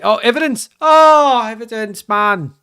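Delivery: high, loud wail at a near miss; agonised exclamation. Real, not acted. Player watching Evidence miss in front of goal, agonised. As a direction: cry out in frustrated disbelief. Striker misses a chance.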